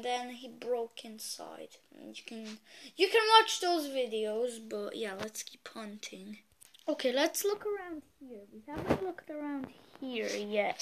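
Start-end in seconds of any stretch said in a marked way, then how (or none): 4.7-6.4 hushed whisper while sneaking. Real, not acted. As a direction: whisper so nobody hears you. Sneaking around hunting a clown.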